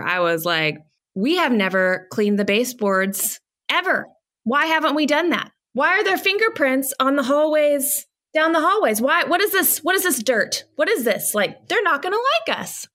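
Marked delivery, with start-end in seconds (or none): 1.1-12.9 higher, rising to a frantic pitch; mock-panic about a messy house, voice climbing; retold panicked rant. Wife retelling her pre-dinner panic to friends, higher and frantic. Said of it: "freaking out before people came over".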